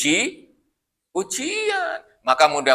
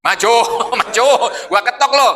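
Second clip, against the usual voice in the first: cocky taunt; 5 semitones higher than usual. Acted. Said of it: "kira-kira Musa ngomong apa".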